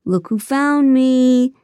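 high, slow sing-song taunt; unnerving sing-song, slow and loud. Acted. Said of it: "using a sing-song voice I found unnerving".

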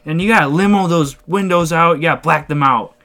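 put-on eager stranger voice; Frankie. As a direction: imitate a guy pushing his truck makeover. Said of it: "And he goes".

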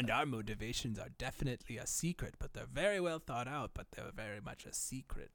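low, softened villain put-on; mock villain. Playing vague conspiracy villains.

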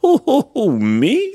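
very high, loud hooting owl. A narrator's reading. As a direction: hoot it in disbelief. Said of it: "said the owl".

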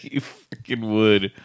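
chuckled out softly; amused laughing. Real, not acted. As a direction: laugh at a friend's predictable answer. Friend amused by the silly pick.

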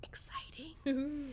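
slow breathy whisper of mock thrill. Host whispering to cohost about a grisly story, hushed. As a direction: hush it like a secret thrill.

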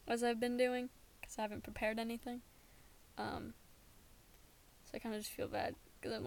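normal talking voice

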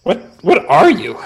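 player's elf asking the brownie, curious. Acted. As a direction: lean in, intrigued. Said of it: "I say curiously".

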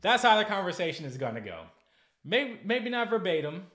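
loud emphatic aside; emphatic declaration. Real, not acted. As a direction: drive the point home loudly. Emphatic outburst after skit.

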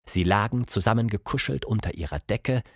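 plain reading voice

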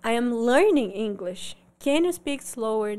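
slow, confident learner English, weight on learning; learner's polite request. Shown as a demonstration. Learner asking an American to slow down, polite and confident. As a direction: ask openly and slowly, as an eager learner. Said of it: "Mas se você falar assim".

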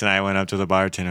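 usual speaking voice

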